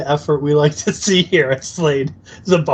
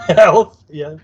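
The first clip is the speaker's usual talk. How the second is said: a real burst of laughter on the word, higher and louder; laughing; 4 semitones higher, 7 dB louder than usual. Real, not acted. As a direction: laugh it out in disbelief. Laughing echo of absurd claim.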